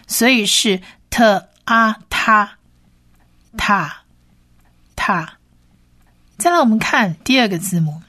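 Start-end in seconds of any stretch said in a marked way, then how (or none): 1.1-5.3 "特啊他" sounded out slowly, T plus short A; 短母音拼读. Shown as a demonstration. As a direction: blend "特" and "啊" into a short "他". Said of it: "它是短母音啊". (慢慢拼读 "特啊他"，短母音)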